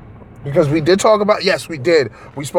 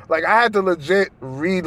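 ordinary talk, nothing marked

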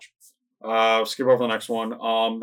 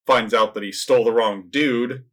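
ordinary delivery

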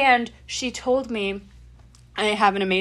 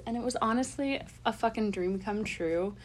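ordinary talk, nothing marked

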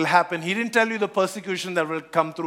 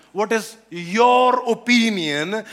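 YOUR drawn out and louder; stress on YOUR; 3 dB louder, slower than usual. Shown as a demonstration. Questioners asking the healed man his view, stress on YOUR. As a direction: lean slowly and heavily on the word YOUR. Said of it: "I'm emphasizing on is".

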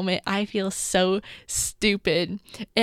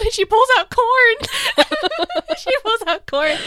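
laughing; 11 semitones higher, 5 dB louder than usual. Real, not acted. Laughing at Pocahontas.

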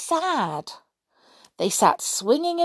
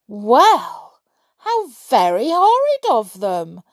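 loud, higher-pitched outburst; indignant cry; 4 semitones higher, 7 dB louder than usual. A narrator's reading. Trickle reacts to the fairies' horrid letter, indignant cry. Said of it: "cried Trickle".

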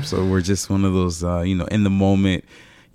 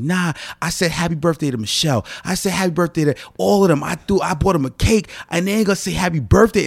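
pitched-up outraged complaint about the missing birthday wishes; retold tantrum; 10 semitones higher than usual. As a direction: rant like a wronged birthday boy. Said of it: "And I'm out here spazzing".

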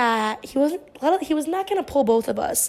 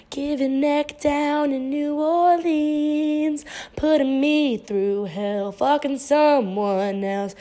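slow, deliberate reading of lyrics, line by line; poetry-style recital; much slower than usual. Pretend. Reading song lyrics to her audience as slow poetry. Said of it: "I'll just sing it in, like, a poetry".

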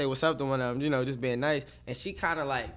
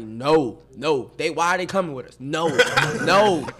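firm annoyed refusal; 7 dB louder than usual. Retold or imitated. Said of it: "I'm like".

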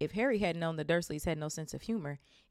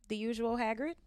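friendly bartender; 6 semitones higher than usual. Acted. Bartender greets Hagrid.

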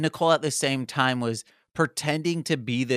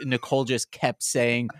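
normal talking voice

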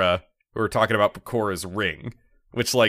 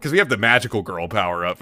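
usual speaking voice

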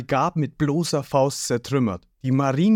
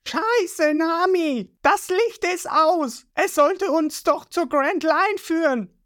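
Ruffy shouting; slower than usual. A narrator's reading. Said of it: "hängt kopfüber an der Galionsfigur und schreit".

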